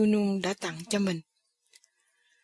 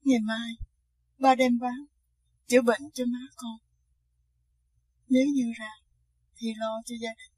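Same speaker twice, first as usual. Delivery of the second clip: whisper sobbing; 2 semitones higher than usual. Acted. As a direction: speak through held-back sobs. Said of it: "tức tưởi nói trong tiếng khóc". (nức nở thì thầm)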